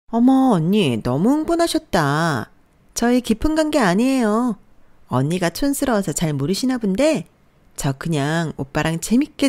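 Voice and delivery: lilting, condescending younger rival; breezy mistress. A narrator's reading. Mistress tells wife to calm down, airily condescending. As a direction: taunt her with mock-sweet nonchalance.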